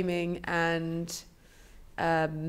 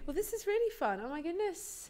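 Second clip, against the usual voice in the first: delighted; 8 semitones higher, 8 dB quieter than usual. Real, not acted. Delighted by the podcast setup.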